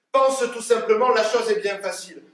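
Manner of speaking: loud, high, projected instruction; emphatic advice. Acted. Father tells daughter acting is easy, loud and emphatic. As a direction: proclaim it as the simple key.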